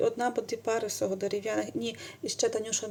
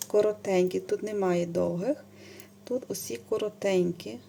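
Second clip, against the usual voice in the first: ordinary talk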